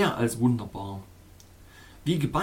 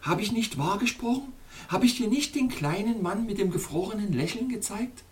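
proud question; 8 semitones higher than usual. Acted. Princess asks proudly.

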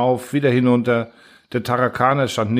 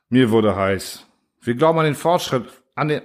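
ordinary delivery, nothing marked

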